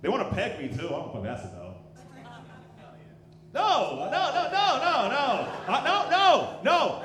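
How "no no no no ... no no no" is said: rising, louder no's; loud refusal. Real, not acted. Comic loudly refusing women who want to peg him. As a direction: protest it louder and higher each time.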